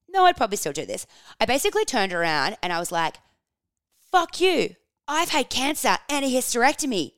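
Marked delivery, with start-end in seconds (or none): 4.1-7.1 much higher, louder snapping outburst; retold fury at a stranger; mock-yelling back at the woman; retold angry yell. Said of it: "that poor woman that I yelled at".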